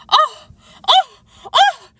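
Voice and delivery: three high, piercing screamed ohs; loud screams. A narrator's reading. The Queen interrupts Alice with loud screams. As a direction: shriek each cry separately, like a steam whistle. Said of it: "the Queen began screaming so loud".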